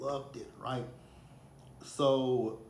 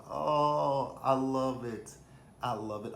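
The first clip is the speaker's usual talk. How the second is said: loud, drawn-out swoon; big delighted "oh", easing off; gushing delight; 3 semitones higher, 6 dB louder, much slower than usual. Retold or imitated. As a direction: gush like you just fell in love with it. Gushing over the country accents.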